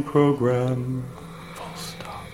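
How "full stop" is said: punctuation breathed in a whisper; whispered dictation. Acted. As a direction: whisper the punctuation marks aside. Reading punctuation aloud.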